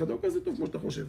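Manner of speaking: wife telling her husband he is not so good; wife's voice. Retold or imitated. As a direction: pitch up into the wife putting him in his place. Wife's voice in acted quarrel.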